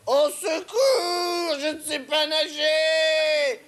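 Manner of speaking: high, slow, desperate cry for help; long stretched high shout for help; drowning man's cry. Acted. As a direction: wail it out like he's going under. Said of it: "et il hurle".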